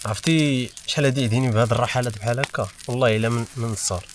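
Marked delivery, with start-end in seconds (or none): none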